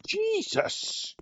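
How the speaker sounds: very high-pitched real startle; startled exclamation. Real, not acted. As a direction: yelp it as if a crash caught you out. Startled by sudden loud crash.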